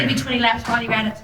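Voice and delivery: quick bossy mum. Acted. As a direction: rattle off a fast order like a mum. Mum sends child outside.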